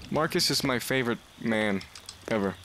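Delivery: ordinary talk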